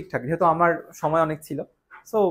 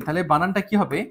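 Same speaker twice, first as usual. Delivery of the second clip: ordinary talk, nothing marked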